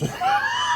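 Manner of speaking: a high burst of loud laughter. Real, not acted. Joke lands, burst of laughter.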